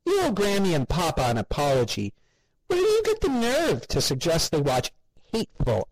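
high, indignant scolding; mother outraged at her son. A narrator's reading. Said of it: "Mother's expression became angry".

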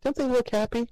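high, sugary chirp to her parents; sweet mother. A narrator's reading. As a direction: talk to them like happy toddlers. Mother cheerful.

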